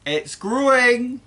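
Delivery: put-on boy shouting up to his grandma; excited yell. A narrator's reading. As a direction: call it out, thrilled. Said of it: "he yelled".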